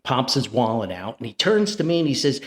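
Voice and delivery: ordinary delivery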